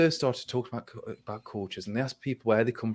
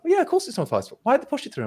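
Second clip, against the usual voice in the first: high mock exasperation; faster than usual. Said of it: "And he's like".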